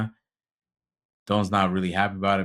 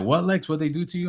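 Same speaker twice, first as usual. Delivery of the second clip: high-pitched, imitating a concerned boss; acted-out startled demand to know; retold alarmed question; 9 semitones higher than usual. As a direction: ask in mock alarm, pitched way up. Boss reacts on overhearing the story.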